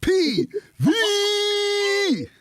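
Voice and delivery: high, slow, drawn-out ring announcer call. Pretend. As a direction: announce him like a wrestling entrance. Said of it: "That's how you introduce him".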